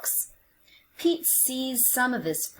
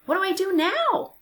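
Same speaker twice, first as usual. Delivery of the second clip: put-on high, eager kid voice; curious little cat; bright questioning Pete; Pete asking; 10 semitones higher, 3 dB louder than usual. A narrator's reading. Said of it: "Pete asks".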